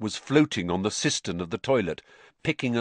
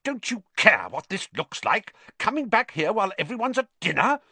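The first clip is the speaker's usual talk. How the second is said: scolding prefect; 12 semitones higher than usual. Acted. Percy scolding.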